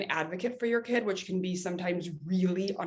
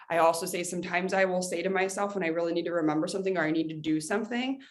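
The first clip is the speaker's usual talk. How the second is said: normal talking voice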